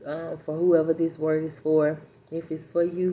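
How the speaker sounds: ordinary talk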